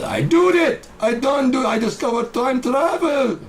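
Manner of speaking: very high, loud falsetto cry; crazed inventor. Acted. Inventor announcing time travel, squealing in falsetto triumph. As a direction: shriek it out in cartoonish triumph.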